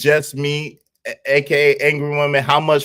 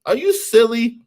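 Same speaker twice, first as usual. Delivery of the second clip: high teasing jab; 8 semitones higher than usual. Real, not acted. Streamer teasing a chatter who asked about money, high mock disbelief. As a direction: pitch jumps way up in mock disbelief. Teasing chat, first take.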